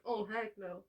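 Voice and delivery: ordinary talk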